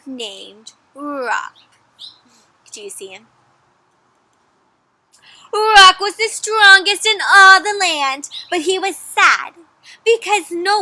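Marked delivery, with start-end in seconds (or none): 5.5-10.8 higher, louder, slow storybook voice; reading a legend to children, high and loud; dramatic narration. A narrator's reading. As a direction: boom out the hero's strength.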